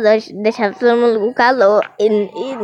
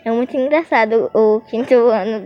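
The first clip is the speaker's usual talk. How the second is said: ordinary talk, nothing marked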